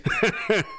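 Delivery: shrill mock laugh, very high; high squeaky laugh imitation. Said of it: "That must be that noise he makes".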